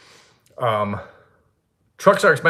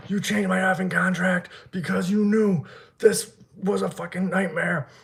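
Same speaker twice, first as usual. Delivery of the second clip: high, slow mock scream without full volume; retold angry screaming; 9 semitones higher than usual. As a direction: imitate yourself screaming at the guy, drawn out. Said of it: "I just start screaming at the guy".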